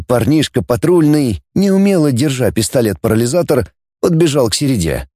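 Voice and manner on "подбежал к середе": plain reading voice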